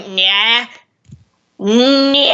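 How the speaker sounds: drawn-out "nyah" to find the character voice; nasal warm-up nyah. Shown as a demonstration. Warming up for Papyrus, long nasal nyah. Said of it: "warm up by just going".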